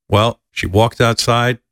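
ordinary talk

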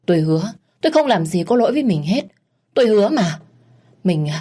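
grovel and beg, voice rising; husband begging his wife to stop hitting him, terrified. Acted. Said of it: "Nghe chồng van xin".

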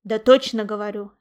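loud, mildly huffy; stung and emphatic; offended insistence. A narrator's reading. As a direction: insist you are telling the truth. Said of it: "обиделся Гром".

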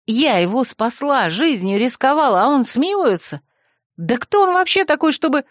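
rising outrage, pitch climbing high; indignant. Acted. Heroine outraged at father. (возмущённо, с нарастанием)

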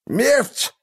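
mumbled through a broken jaw; lisping mumble. Acted. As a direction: slur it toothlessly. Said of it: "прошамкало спереди". (шамкающая угроза)

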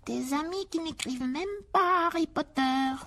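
a hint of slyness in the high elf voice; mildly sly high elf remark. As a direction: needle him slyly. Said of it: "dit Dobby d'un ton sournois".

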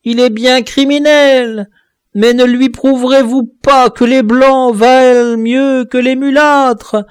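high, loud, slow desperate begging; terrified dwarf begging for mercy, high and loud; pleading in terror. Acted. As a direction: beg for your life from the edge. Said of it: "suppliante et douloureuse".

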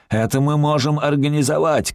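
high, sustained call; calling back. A narrator's reading. Cop shouts back agreeing to robber's demands. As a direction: call agreement across a distance. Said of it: "кричит".

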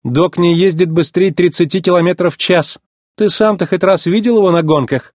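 high-pitched sceptical challenge; lightly voiced doubting woman; doubtful. A narrator's reading. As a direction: question it as plainly unlikely. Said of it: "усомнилась Фло".

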